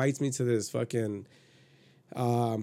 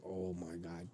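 very soft, low retold reaction; hushed astonishment; 6 semitones lower, 17 dB quieter than usual. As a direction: murmur it as if to yourself. Retells his inner reaction to seduction.

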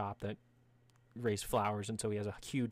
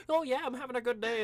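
high, louder cheery small talk; chatting to plants; 13 semitones higher, 5 dB louder than usual. Acted. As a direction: play an oblivious Minotaur chatting away. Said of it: "always talking to himself".

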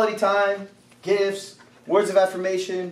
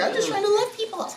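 high, quick, hurt-innocent whine; hammed-up defensive plea, softly squeaked; mock protest; 12 semitones higher, 3 dB quieter, faster than usual. Voices well-meaning hugger protesting.

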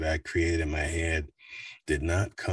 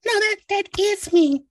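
high, bright character voice; 9 dB louder than usual. Retold or imitated. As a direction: pop up into a high head voice. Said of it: "And like".

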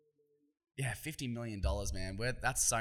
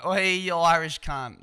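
loud, higher Australian hollering; Australian accent; 6 semitones higher, 12 dB louder than usual. Shown as a demonstration. Showing how the insult sounds fine at home, loud Australian accent. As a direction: call it out in a broad Australian voice. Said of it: "it's fine in our voice".

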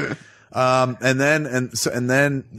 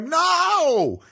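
high, panicked protest; hammed-up outrage, much higher; mock panicked Bruce; 12 semitones higher than usual. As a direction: squeal in mock horror at being exposed. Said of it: "You're like".

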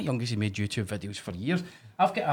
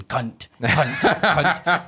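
mimicking kids swearing; 3 semitones higher, 6 dB louder, much slower than usual. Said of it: "these wee guys are just going".

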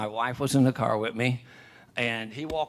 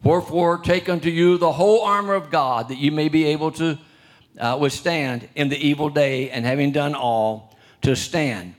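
raised, ringing pulpit reading; preacher proclaiming Ephesians, louder and higher; scripture reading; 3 semitones higher, 4 dB louder than usual. Acted. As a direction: declaim the passage to the church. Reads scripture aloud.

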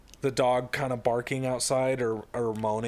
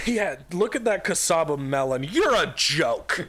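mock jeering; 5 semitones higher, 5 dB louder than usual. Dad insulting the neighbour's garden, mock jeering. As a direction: jeer loudly in a play quarrel. Acted quarrel over garden vegetables.

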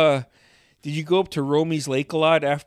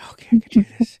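psyching himself up; 8 semitones higher, 8 dB louder, much faster than usual. Retold or imitated.

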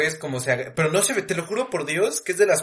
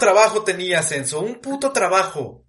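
mock scolding; 5 semitones higher, 4 dB louder than usual. Mock scolding Enzo over cousin.

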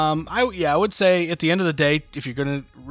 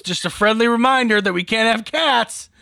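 raised, louder mock-cheery reminder; 6 semitones higher, 4 dB louder than usual. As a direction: play up a pointed friendly reminder. Acts out playing up his allergy.

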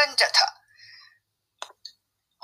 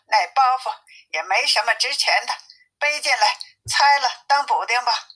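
ordinary delivery, nothing marked

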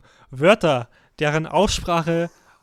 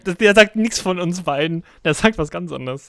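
excited protest; 4 semitones higher, 7 dB louder, slower than usual. Real, not acted. Excited about translator result.

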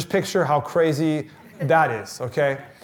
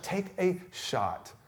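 softer, very slow plea; slow hushed urging; 3 semitones higher, 10 dB quieter, much slower than usual. Acted. Preacher quietly inviting people to step in. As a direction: draw it out gently.

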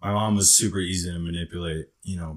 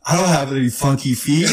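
higher and louder, laughing through it; laughing denial; 8 semitones higher, 10 dB louder than usual. Retold or imitated. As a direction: protest while cracking up, half-joking. Standing up denying at meeting.

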